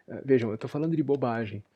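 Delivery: normal talking voice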